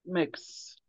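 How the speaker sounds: normal talking voice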